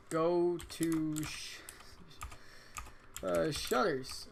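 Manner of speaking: dragging each word slowly; slow typed dictation. Shown as a demonstration. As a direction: spell out the command slowly. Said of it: "Go to shutters".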